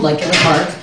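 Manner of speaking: ordinary talk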